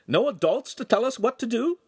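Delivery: thrilled, high-pitched question; delighted. A narrator's reading. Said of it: "he asked in a delighted tone".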